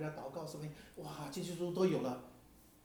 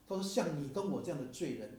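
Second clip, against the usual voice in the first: ordinary talk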